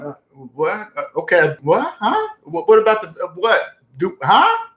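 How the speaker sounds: halting, confused mock stammer with rising questions; baffled novice. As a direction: play someone totally lost, jumpy in pitch. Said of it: "here's the person that don't know nothing about money".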